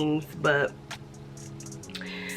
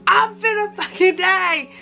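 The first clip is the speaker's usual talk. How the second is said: protesting to her cousin in a hammed-up panic; retold panic; 12 semitones higher than usual. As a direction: squeal it out like it's the end, much higher.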